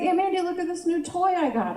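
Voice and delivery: put-on high, quick little-kid voice; excited kid showing off a present; six-year-old nephew. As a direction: pipe up like an eager small child. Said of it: "My nephew is six years old".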